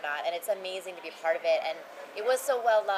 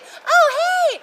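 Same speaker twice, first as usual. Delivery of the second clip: much higher, louder, delighted hello; excited greeting; 12 dB louder than usual. Retold or imitated. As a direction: greet them like a long-lost friend. I'll be.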